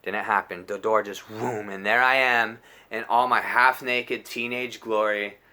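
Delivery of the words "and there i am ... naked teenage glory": ordinary delivery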